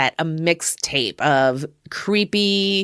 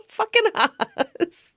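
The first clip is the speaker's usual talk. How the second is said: high, drawn-out words through real laughter; laughing; 11 semitones higher, much slower than usual. Real, not acted. Fan quoting a favorite livestream reply, cracking up. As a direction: crack up on the punchline.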